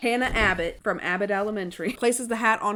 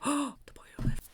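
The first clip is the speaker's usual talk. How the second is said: soft, higher awed hush; whispering crowd; 6 semitones higher, 6 dB quieter, faster than usual. Retold or imitated. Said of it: "everybody's whispering".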